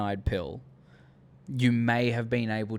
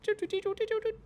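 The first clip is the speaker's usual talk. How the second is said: high sung doo doo doo imitating clicking; typing noises; 6 dB quieter, much faster than usual. Tech clicking on the panel, high doo doo imitation. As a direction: chirp the keypad taps quickly. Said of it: "he's like".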